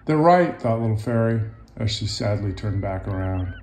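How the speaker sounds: lively picture-book narration; storybook read-aloud voice; reading to children. A narrator's reading. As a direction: tell it gently to young listeners.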